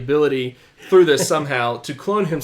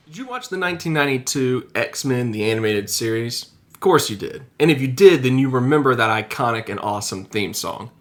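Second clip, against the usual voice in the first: upbeat host read; video narration; 3 semitones lower than usual. Acted. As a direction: read it like a show intro. Scripted video intro read.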